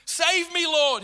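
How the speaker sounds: high, loud cry for rescue; shouted plea. A narrator's reading. Peter sinking in the waves, shouting to Lord for rescue. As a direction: yell it like a man going under. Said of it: "he shouted".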